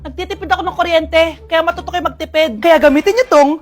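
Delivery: brash mum-style ad read; loud product pitch. Acted. Mother pitching a fan to her kids, loud ad read.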